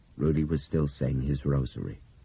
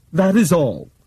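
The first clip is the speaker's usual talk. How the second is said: higher, clipped final statement; Bob's curt sign-off; 13 semitones higher, 10 dB louder than usual. Acted. Bob ending his intercom announcement, curt sign-off. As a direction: close it off flatly.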